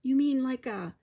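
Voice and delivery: mildly meek hesitant ask; frightened twin asking about the monster. A narrator's reading. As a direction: ask timidly. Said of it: "Tommy asked meekly".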